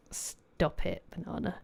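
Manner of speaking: whispered, soft teasing; playful whisper. Real, not acted. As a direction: breathe it out like a playful scold. Teasing a chatter by name.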